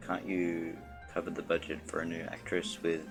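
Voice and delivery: normal talking voice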